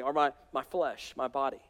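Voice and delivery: ordinary talk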